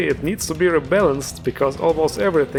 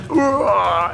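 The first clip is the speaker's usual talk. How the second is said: long, high mock roar; drawn-out "hurrah" roar in imitation; imitated battle roar; 5 semitones higher than usual. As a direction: draw out an imitated growling yell. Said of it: "He was like".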